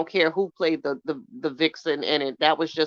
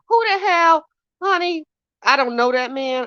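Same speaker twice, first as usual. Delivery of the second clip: incredulous outrage; 10 semitones higher, 4 dB louder than usual. Real, not acted. Outraged at the rapper's claim.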